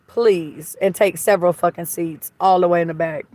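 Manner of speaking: ordinary delivery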